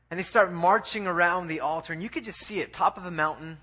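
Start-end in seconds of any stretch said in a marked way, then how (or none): none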